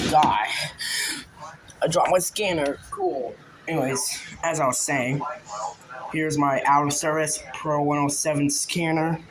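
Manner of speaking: ordinary delivery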